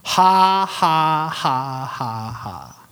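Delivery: slow fake "ha, ha", falling away; put-on laugh. Preacher imitates man laughing on purpose at devil, put-on laugh. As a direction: force out a deliberate, mirthless laugh. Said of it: "I just put it on".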